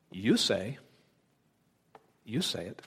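You say, with You stressed and soft; quiet answer leaning on You. Retold or imitated. Jesus answering Pilate calmly, emphatic You. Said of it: "He intends to say it something like this".

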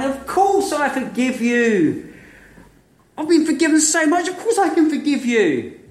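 forgive him gladly through a laugh; joyful forgiveness. Acted. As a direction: servant embracing his debtor, overjoyed. Imagined happy ending embrace.

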